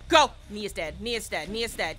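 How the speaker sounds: ordinary delivery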